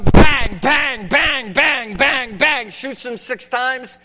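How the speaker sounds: shouting bang bang like gunfire; loud mock gunshots; shouted gunshots. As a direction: bark each bang like a shot. Imitating gunshots in story.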